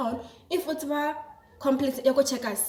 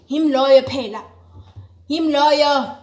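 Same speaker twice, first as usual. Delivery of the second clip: louder, punchy announcement; loud exclamation; 8 dB louder than usual. Real, not acted. To viewers, a loud excited announcement. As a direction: announce it with a bang.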